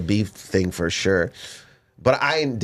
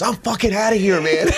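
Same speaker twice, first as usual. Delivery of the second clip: angry friend; 9 semitones higher than usual. Retold or imitated. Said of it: "he goes".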